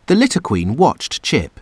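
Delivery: usual speaking voice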